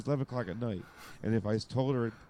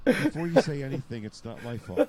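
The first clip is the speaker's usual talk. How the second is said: blurted through laughter, louder; mock confession to wife, laughing; laughing excuse; 5 semitones higher, 6 dB louder than usual. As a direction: giggle out the excuse in a rush.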